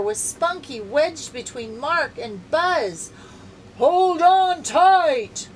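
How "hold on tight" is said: much higher, louder, slow call. Acted. Ranger shouts to the boys on the rope. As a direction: call out over the falls. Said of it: "called the park ranger".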